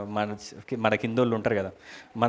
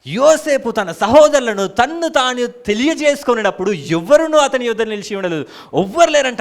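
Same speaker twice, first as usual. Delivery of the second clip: loud, high-pitched preacher's declamation; full-force preaching; 8 semitones higher, 8 dB louder than usual. Real, not acted. Preacher telling the congregation how Joseph wept aloud, in full-force preaching. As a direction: proclaim the story at the top of your register.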